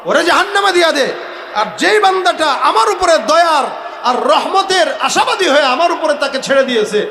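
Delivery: high, loud commanding verdict; full-force preaching. Acted. Allah orders the angel to carry out judgement, full-force preaching.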